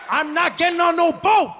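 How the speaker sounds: shouted, dug-in refusal; defiant shout. Retold or imitated. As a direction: yell it back, refusing outright. Refusing to board the boat.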